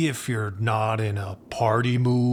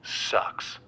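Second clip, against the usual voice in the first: whispered jab; 7 dB quieter than usual. Acted. Badmouthing the friend's ex.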